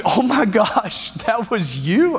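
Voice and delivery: slow, drawn-out amazed question; surprised realization. Retold or imitated. Wife learns her husband was the guy, slow amazed disbelief. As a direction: let the realization dawn slowly.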